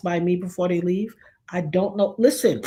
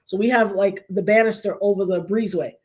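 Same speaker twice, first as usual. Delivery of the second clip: normal talking voice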